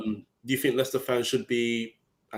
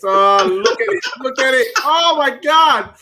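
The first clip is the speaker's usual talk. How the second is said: excited laughing outcry; 11 semitones higher, 9 dB louder, slower than usual. Real, not acted. Reacting to teasing, laughing.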